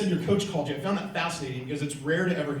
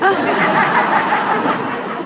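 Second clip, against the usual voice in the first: loud, high, breathless burst of laughter; 13 dB louder than usual. Real, not acted. Joking about punching the trainer, loud laughter. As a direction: let out a big, airy laugh.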